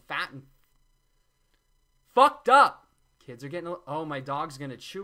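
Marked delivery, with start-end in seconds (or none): 2.1-2.9 much higher and louder, blurted out; sudden shout. Real, not acted. Vlogger ranting about sick kids, shouting. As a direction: burst out in outrage.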